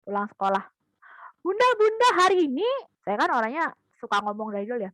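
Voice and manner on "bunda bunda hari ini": put-on high, loud child calling mum; little kid calling for mum; excited child. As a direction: burst in like an eager kid home from school. Speaker as child calling mother.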